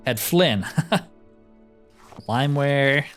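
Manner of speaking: ordinary delivery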